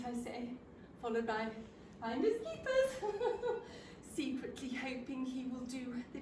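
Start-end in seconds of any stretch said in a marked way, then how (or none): 2.0-3.6 laughing teasing quote, pitched up. Acted. Woman teasing the stranger over the last sandwich, laughing. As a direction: giggle through it playfully.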